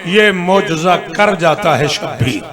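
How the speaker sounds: loud, ringing verse recitation; declaimed elegy. Acted. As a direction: proclaim the couplet to the congregation at full voice.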